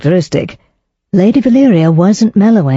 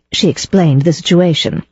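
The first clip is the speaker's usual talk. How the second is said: ordinary delivery, nothing marked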